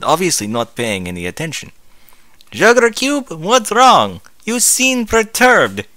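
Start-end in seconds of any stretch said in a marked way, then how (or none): 2.5-5.9 high, loving Southern voice; a gentle check on her wife; lovingly. Acted. Applejack asks her wife lovingly what's wrong. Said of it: "A J said lovingly".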